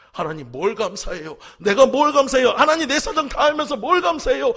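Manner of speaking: loud, high, rising fury at God; angry protest. Retold or imitated. Pastor arguing with God over being told to give thanks, a loud angry protest. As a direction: throw the question back at God in anger. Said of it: "너무나도 화가 나는지".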